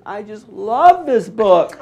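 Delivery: high, slow gushing mama; mother's voice. Retold or imitated. Son retelling mother loving his book, high gushing. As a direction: gush it out slowly as mama.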